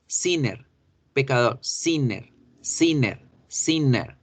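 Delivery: "sinner" with a clear alveolar n; contrast demonstration. Shown as a demonstration. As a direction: model "sinner" with tongue tip on the n. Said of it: "la diferencia de las dos".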